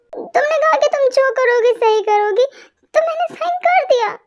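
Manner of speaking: high-pitched, wounded protest; hurt self-defence. Acted. Hurt woman defends herself in quarrel. (आहत होकर ऊँचे सुर में सफ़ाई देते हुए)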